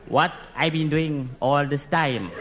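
low, slow echo of a question; slow, weary disbelief; incredulous echo. Acted. Echoes boss's question in disbelief.

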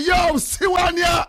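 mock shout. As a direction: yell it across the racetrack like the boy who spotted him. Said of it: "One boy I know see me and say".

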